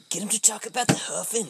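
handler in his ear. Retold or imitated. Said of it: "someone in his ear going".

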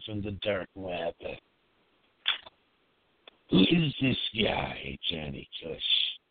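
low magnetic voice. Acted. Dark web troll persona monologue.

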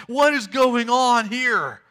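loud, high, drawn-out disbelief; amazed, freaked out. Acted. Crowd sees the dead man walking, loudly amazed and freaked out. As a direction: stretch out the astonished question at full voice. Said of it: "amazed and a little freaked out".

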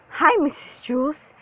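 high, out-of-breath hello; high gasping greeting; gasped greeting. A narrator's reading. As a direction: gasp it out after a long climb. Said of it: "he gasped".

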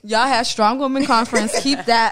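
loud, playful telling-off; mock confrontation. Host tells off imagined copycats, mock confrontational. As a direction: call them out loudly, half in fun.

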